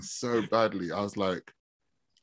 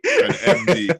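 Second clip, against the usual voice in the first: excited; 10 semitones higher, 11 dB louder, slower than usual. Acted. Teasing repeat of medical doctor.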